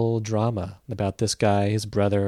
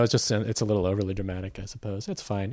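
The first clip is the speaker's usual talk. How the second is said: ordinary talk, nothing marked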